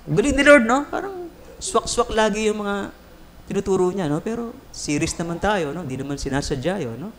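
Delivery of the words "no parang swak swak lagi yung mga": normal talking voice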